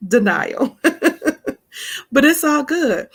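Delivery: words bubbling out through laughter; laughing. Real, not acted. Amused by own mimicry of denial, laughing. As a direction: laugh it off, loud and rising high.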